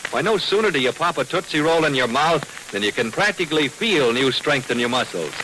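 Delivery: normal talking voice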